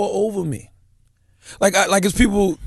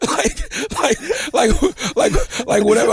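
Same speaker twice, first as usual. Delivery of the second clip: laughing squeaky like; giggling through the words; 7 semitones higher, much slower than usual. Real, not acted. Laughing fit.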